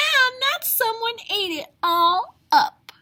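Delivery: high, loud little cub; upset Baby Bear. A narrator's reading. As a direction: wail it like a tiny bear. Baby Bear's porridge gone.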